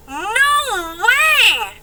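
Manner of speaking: normal talking voice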